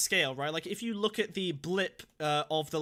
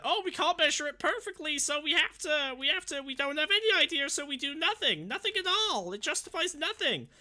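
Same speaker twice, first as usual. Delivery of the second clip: high, far-off mocking whine; mock him in a high whiny voice; mocking Peterson voice; 10 semitones higher than usual. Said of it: "Peterson here is, like, appealing to this idea that".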